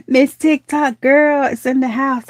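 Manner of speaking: high, slow announcing welcome; excited greeting. Real, not acted. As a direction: stretch out a high, delighted shout-out. Welcomes arriving viewer once more.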